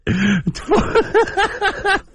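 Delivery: high laughter; a long laugh; big laugh. Real, not acted. Loud laugh alone.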